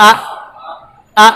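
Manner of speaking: "আ" cut short on a hamza stop; no stretch. Shown as a demonstration. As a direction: say "আ" briefly with a catch, without lengthening. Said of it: "এখানে কোনো টান টান কিছু হবে না". (টান ছাড়া ছোট "আ")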